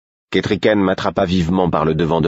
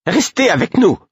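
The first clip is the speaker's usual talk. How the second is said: high, loud and curt; curt command; 5 dB louder than usual. Acted. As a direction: snap it at him sharply. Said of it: "fit-elle sèchement". (sèchement, fort et aigu)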